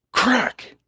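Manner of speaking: high sharp rifle crack; gunshot crack. A narrator's reading. Narrator voices the rifle firing, a sharp crack. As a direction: snap out the shot.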